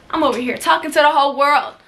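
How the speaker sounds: normal talking voice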